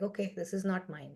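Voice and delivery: soft, detached retold acceptance; emotionless. Father watching his house burn without attachment, emotionless and quiet. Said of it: "without any, any sort of emotion attached".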